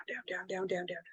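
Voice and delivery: whispered down, down, down; whispered fading. Shown as a demonstration. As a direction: sink into a whisper. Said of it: "their energy is going down".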